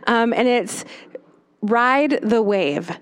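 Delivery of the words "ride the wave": "Ride the wave." said slowly, each word weighted; slow key phrase. Shown as a demonstration. Speaker handing listeners a tool, slow and deliberate. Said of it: "this great phrase ... hand to you as a tool".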